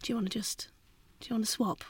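softly whispered offer. Retold or imitated. Whispering to the struggling driver in the car. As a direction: whisper it so the driver won't feel bad. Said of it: "one of us will end up going".

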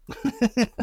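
a short, high laugh that covers holding back tears; choked laugh. Real, not acted. Father laughing to keep from crying over his son. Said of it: "this part kills me".

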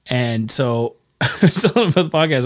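usual speaking voice

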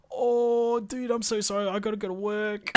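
high apologetic bloke voice; character voice. Retold or imitated. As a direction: squeak out a guilty apology.

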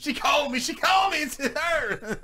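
high-pitched, giddy squeal; mock thrilled fan. Co-host imagining the fan's reaction, mock-thrilled squeal. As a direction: play an overjoyed fan bursting with news.

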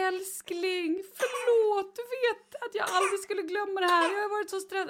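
high mock apologetic husband; husband begging wife's forgiveness at the door; pleading husband. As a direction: ham up the sorry husband, pitched high.

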